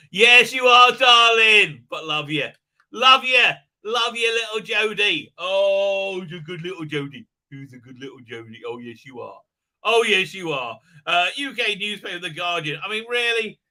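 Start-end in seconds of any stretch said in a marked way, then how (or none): 0.1-10.8 cooing pet talk. Real, not acted. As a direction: coo at the little dog.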